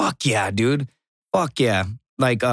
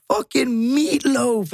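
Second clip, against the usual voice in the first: high, slow jeering drawl; mock mocking guy; 11 semitones higher, much slower than usual. Marine mocking his shirtless buddy, high slow jeer. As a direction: stretch it out, mocking. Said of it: "And he goes".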